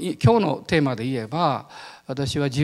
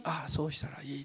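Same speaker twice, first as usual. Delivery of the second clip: softly whispered, much quieter; whispered realization; 12 dB quieter than usual. Retold or imitated. Preacher recalling his thought, whispered. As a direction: whisper it as a sudden inner yes.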